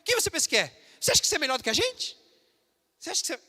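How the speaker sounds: a lightly acted sneering coworker; mocking coworkers. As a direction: confront the believer like a jeering colleague. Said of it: "você vai ser chacoteado".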